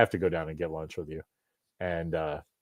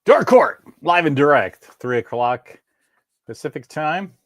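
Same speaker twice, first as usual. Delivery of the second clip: show announcer; 6 semitones higher, 8 dB louder than usual. Acted.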